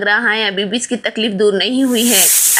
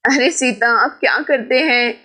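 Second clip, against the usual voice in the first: ordinary delivery, nothing marked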